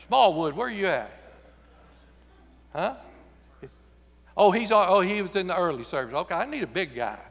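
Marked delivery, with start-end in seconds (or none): none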